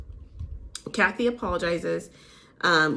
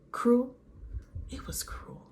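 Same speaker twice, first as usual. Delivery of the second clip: imitated therapist, sinking to a hushed whisper; mock-grave, softly prompting, then whispered; quiet therapist voice; 4 semitones higher, 6 dB quieter than usual. Lisa prompts upset Kathy, imitated in a quiet therapist voice. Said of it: "She's using this quiet therapist voice".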